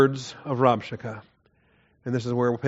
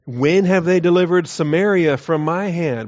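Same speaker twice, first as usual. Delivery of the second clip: louder, higher taunting demand; declaimed challenge; 5 semitones higher, 7 dB louder than usual. Acted. Assyrian envoy challenging the men on the wall, declaimed challenge. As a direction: throw out the challenge in a raised voice.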